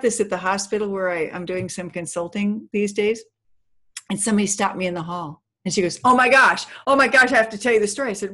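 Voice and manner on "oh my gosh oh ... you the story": loud, higher, rushed gush; breathless-fast excited outburst, louder and higher; excited, retold. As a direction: imitate someone bursting to share news. Retold excited woman stops speaker.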